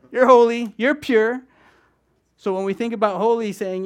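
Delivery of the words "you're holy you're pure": loud declaration. Real, not acted. Preacher to congregation, a loud high proclamation. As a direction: ring out high and loud: you're holy, you're pure.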